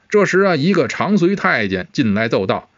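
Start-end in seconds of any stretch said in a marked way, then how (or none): none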